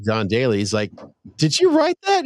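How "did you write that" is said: high mock-incredulous voice; retold question. Friend retelling Tucker asking John Daly, high mock disbelief. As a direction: squeak it out in disbelief.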